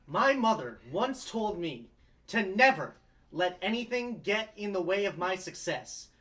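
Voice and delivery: usual speaking voice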